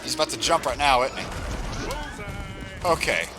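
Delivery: ordinary talk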